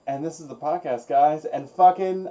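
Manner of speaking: normal talking voice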